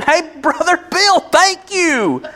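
high, slow mock hail to the president; mock praise. Pastor mocking thanking Bill Clinton, high slow mock praise. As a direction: ham up thanking the president like a dear leader. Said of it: "I didn't go around saying".

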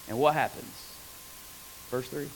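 ordinary talk

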